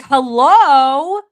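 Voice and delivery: loud, slow, drawn-out "hello?"; exasperated hello. Acted. Host scolds the chat for saying stupid stuff, loud and drawn out. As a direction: stretch out "hello?" at the chat in disbelief.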